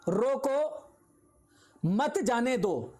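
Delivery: "रोको", long pause, then "मत जाने दो" as one phrase; pause after रोको. Shown as a demonstration. Teacher shows what was said, pausing after "रोको". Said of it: "समझ में तुम्हारे कुछ और आ रहा है".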